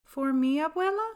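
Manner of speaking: put-on delighted little boy can't believe it; surprised child. Child Julian surprised by gift.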